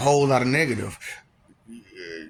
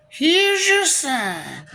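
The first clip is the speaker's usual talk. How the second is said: drawn-out mock punchline; 11 semitones higher, much slower than usual. Comic punchline to a dumb remark.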